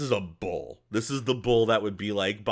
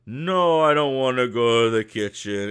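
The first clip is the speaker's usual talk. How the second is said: louder, whiny refusal; reluctant goblin; 3 semitones higher, 7 dB louder than usual. Acted. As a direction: dig in your heels about the kitchen.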